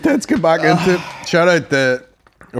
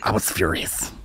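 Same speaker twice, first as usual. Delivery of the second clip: low, soft stand-up comic voice; Dave Chappelle impression; 9 semitones lower, 9 dB quieter than usual. Comic imitating a stand-up's famous voice, low and soft. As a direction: drop it low and confide it. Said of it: "I'd take Chappelle's".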